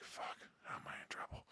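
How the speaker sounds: panicked whisper to himself. Retold or imitated. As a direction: breathe it out so nobody hears. Panicked aside before the hallway talk.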